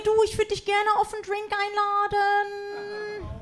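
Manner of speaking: put-on high, soft, slow boyish voice; young guy voice. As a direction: play a shy teenage boy asking her out. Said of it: "der sagt zu mir".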